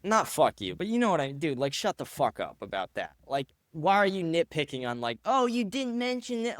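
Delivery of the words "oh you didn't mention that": high, whiny mock of a nitpicker; mocking whine. Mocking a nitpicking commenter, in a high whiny voice. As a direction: sneer it back in a high whiny voice.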